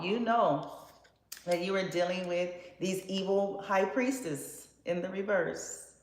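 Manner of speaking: normal talking voice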